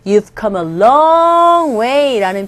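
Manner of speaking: slow, deliberate model sentence; slow demo read. Shown as a demonstration. Teacher reading a phrase slowly to students. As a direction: stretch each word for learners. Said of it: "우리 배웠던 표현".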